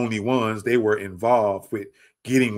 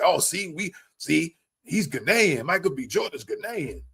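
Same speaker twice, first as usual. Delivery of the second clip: high, swooping mock-excited quote; put-on high-pitched crowing; mocking imitation; 7 semitones higher than usual. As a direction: mimic gloating publications in a high singsong. Imitates publications jumping on the claim.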